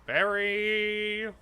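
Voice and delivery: sing-song voice. Retold or imitated. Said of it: "saying Barry over, over in sing-song voice".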